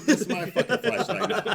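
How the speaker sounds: high, loud gloating laugh of a cop; thieving cop. Retold or imitated. Mocking a cop pocketing a flashlight, loud and laughing. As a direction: gloat over the stolen flashlight.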